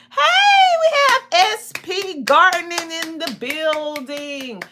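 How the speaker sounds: high, drawn-out "hey" ringing into a welcome; excited shout-out. Real, not acted. Host greets a viewer joining the livestream, excited shout-out. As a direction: hail a new arrival with big energy.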